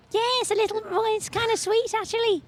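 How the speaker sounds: high-pitched Liverpudlian, back in the throat; Scouse accent. Shown as a demonstration. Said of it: "That's a terrible accent".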